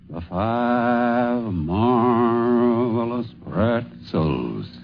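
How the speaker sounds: very slow, drawn-out circus announcer call; megaphone announcer. Acted. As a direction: stretch every word like a ringmaster over a crowd. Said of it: "a man with a big musical megaphone".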